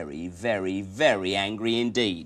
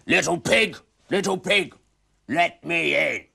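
loud, higher big bad wolf; 8 semitones higher, 9 dB louder than usual. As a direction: call through the door like a wolf. Wolf at the door.

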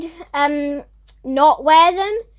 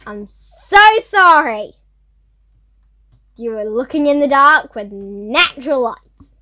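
ordinary delivery

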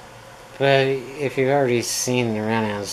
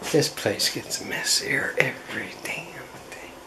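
grumbling whisper; 4 dB quieter than usual. Real, not acted. Muttering about the mess.

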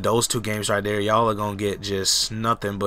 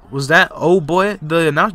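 surprised; 8 semitones higher, 8 dB louder than usual. Real, not acted. Puzzled at announcer beep.

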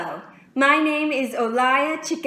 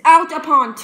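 loud, higher burst of scorn; exclaiming; 5 semitones higher, 11 dB louder than usual. Acted. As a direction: snap it out loudly at yourself. Monologue, scolding herself.

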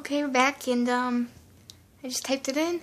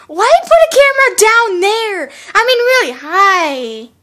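loud, high exasperated complaint; exasperated outburst; 4 semitones higher, 13 dB louder than usual. Real, not acted. Viewer questioning the camera down there, exasperated outburst. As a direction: burst out in loud protest at what you see.

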